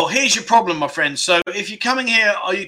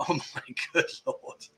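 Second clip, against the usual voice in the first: stunned whisper; 12 dB quieter than usual. Real, not acted. Shocked by the lady boy room.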